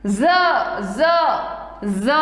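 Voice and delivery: "ज" said as a buzzed "za", three separate times; Z sound. Shown as a demonstration. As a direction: demonstrate "ज" as za with pauses between. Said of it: "ये वाला ज". ("ज" को नुक़्ते वाला ज़ बोलते हुए)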